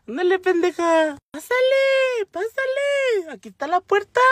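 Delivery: high, squeaky cartoon rat voice; high-pitched jeering little creature; taunting rat. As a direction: mockingly usher someone through, in falsetto. Voicing the mocking rat's taunts.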